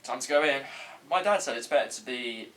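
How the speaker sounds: ordinary talk, nothing marked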